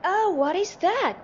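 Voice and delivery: high, loud curious cry; high-pitched shouted wonder; excited shout. A narrator's reading. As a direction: call out the question in astonishment. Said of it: "Kitty shouted".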